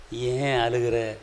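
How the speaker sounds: slow, low, soft question; gentle asking. Acted. Jesus looks at the congregation and asks, gently. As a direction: ask tenderly, stretching the words.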